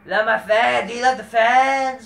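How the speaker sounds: high, loud mock wrestler fawning over his fans; mock wrestler calling out to his fans, loud and high. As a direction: ham up a cheesy babyface thanking the crowd. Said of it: "some dude named Dominic".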